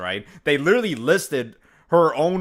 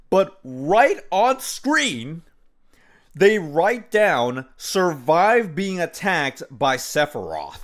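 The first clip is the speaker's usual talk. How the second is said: exasperated; 7 semitones higher, slower than usual. Real, not acted. Exasperated at reaction video claim.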